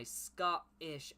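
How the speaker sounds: "Scottish" broken at a dash instead of the TT; dropped T T. Acted. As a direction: say "Scottish" as Sco'ish, gap in place of the TT. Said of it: "there's a deliberate dash instead of the T T".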